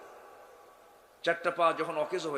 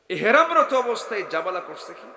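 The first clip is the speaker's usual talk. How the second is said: raised, louder challenge; rhetorical question; 5 semitones higher, 9 dB louder than usual. Real, not acted. Preacher demanding an answer from listeners. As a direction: throw the question at the crowd.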